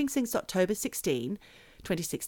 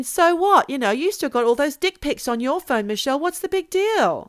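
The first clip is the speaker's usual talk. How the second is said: higher, louder teasing challenge; mock listener retort; 7 semitones higher, 4 dB louder than usual. Host teasing her co-host, mock challenge, pitched up and loud. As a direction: mimic a smug listener, pitched up. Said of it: "you're probably thinking".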